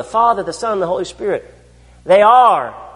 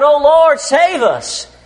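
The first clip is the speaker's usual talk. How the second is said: higher, louder desperate plea; cry for help; 9 semitones higher, 5 dB louder, much slower than usual. Acted. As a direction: call out in panic. Apostles crying out in storm.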